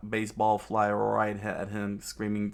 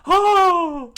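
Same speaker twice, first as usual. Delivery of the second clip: very high, very loud shriek; scream; 16 dB louder than usual. Acted. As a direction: shriek as she grabs your feet. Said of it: "he screamed out".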